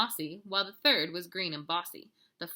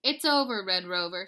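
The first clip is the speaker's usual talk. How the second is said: firm mom; 8 dB louder than usual. A narrator's reading. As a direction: settle him down like a mom. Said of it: "said Mama".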